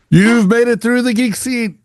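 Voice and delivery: high, loud, hammed-up prize announcement; game-show announcer. Host cheering his guest for a second win, loud game-show announcer.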